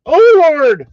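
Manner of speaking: a high, very loud hammed-up yelp; mock falling scream. Imagining a friend falling down an escalator, hammed-up scream. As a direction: belt out a cartoon cry while tumbling. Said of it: "I just wanna hear the sounds".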